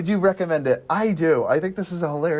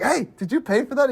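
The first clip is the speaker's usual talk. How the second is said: mock cart lady; 10 semitones higher than usual. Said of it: "She's like".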